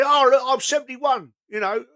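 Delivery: put-on high, loud boastful drunk voice; drunk old man. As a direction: brag like a tipsy old pub regular.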